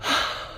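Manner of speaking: a long, soft sigh of release; relieved sigh. Retold or imitated. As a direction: let the breath go in relief. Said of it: "all I could do was just".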